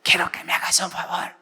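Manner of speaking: whispery mock-sick plea; voiceless pastor. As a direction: ask a favor with no voice left. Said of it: "Me quedé sin voz". (súplica afónica imitada)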